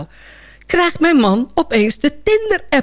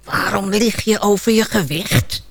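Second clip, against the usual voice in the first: hissing gadget voice. Retold or imitated. Phone's speech mode nags its owner, hissing. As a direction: hiss it slowly, like a nagging device. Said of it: "Hoor ik hem sissen".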